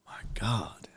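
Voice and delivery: a weary whispered my God; exasperated whisper. Real, not acted. Fed up with spam, whispering to himself.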